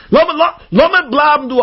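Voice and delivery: high, insistent wheedling; pushy tempter. Acted. The Yetzer Hara asking to stay for Shabbos, pushy. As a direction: push your way in, high-pitched.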